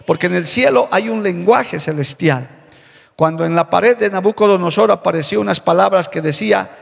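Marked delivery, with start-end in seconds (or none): none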